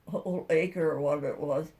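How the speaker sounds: ordinary talk, nothing marked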